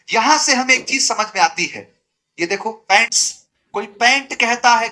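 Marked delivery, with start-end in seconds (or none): none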